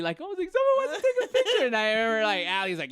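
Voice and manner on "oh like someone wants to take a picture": giddy, very high-pitched squeal of joy; so happy. Retold or imitated. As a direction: squeal it like an overjoyed fan. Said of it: "I got so happy".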